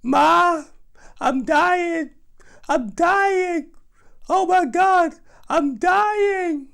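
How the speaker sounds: high, loud wailing cry; yelling I'm dying. Retold or imitated. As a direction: wail to your mother. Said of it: "I continued to just yell out".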